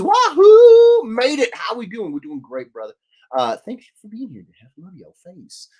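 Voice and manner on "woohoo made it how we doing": whooping high and loud; excited viewer cheer. Retold or imitated. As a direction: belt out the viewer's wahoo. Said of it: "the Hef says".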